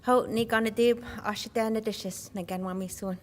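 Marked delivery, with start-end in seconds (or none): none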